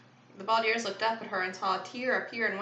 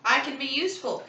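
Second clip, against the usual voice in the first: higher-pitched protest; insistent; 6 semitones higher, 3 dB louder than usual. A narrator's reading. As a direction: insist on your worth. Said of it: "Kevin insisted".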